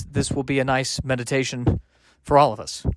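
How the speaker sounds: normal talking voice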